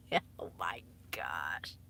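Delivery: hide in the words, barely voiced; mortified whisper. Real, not acted. Streamer to her audience, whispering in embarrassment.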